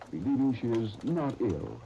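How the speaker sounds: ordinary delivery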